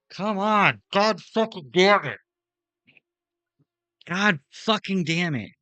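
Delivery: loud, slow, furious swearing at the game; frustrated cursing. Real, not acted. Streamer cursing at his racing car, real furious shout. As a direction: really lose it at the crash.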